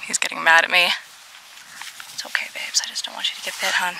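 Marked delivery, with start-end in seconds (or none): 2.1-3.9 tender whispered comfort for the dog; reassuring whisper. Real, not acted. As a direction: whisper softly to calm the dog.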